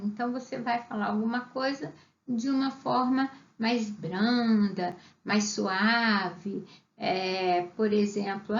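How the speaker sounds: ordinary delivery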